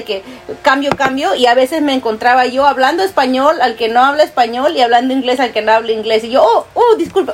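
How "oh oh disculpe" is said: high, quick "oh, oh" then a flustered sorry; startled apology. Retold or imitated. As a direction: act caught off guard, apologizing. Catching herself in wrong language. (sorpresa apenada, en voz aguda)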